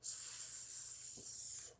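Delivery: "S" as a long, soft hiss, no vowel; letter-sound drill. Shown as a demonstration. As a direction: hiss "S" out steadily like a snake. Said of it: "Sammy Snake says".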